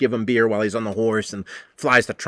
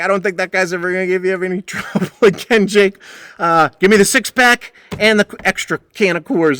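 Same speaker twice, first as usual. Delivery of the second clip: a hint of a gruff tough guy, higher; lightly voiced bar bouncer; smug tough guy, mildly hammed; 6 semitones higher, 4 dB louder than usual. Blanton retold, tough guy gloating.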